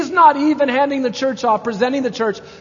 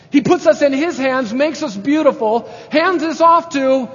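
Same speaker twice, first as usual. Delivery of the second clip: higher, louder climactic preaching; preaching at full force; 4 semitones higher, 4 dB louder than usual. Acted. Pastor to congregation, fervent climactic preaching.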